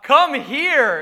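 loud, drawn-out parental order; summoning call. Acted. Father calling his yelling kid over, loud slow command. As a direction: stretch it out as a firm summons.

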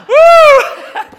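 high, loud whoop; relieved whoop. Real, not acted. Preacher relieved his dog call worked, high laughing whoop.

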